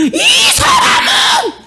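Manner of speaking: very high, drawn-out shriek; possessed shaman. Acted. Possessed fortune-teller proclaiming before the people, piercing high shriek. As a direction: wail it out like a spirit-possessed medium. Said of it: "귀신 들린 손으로".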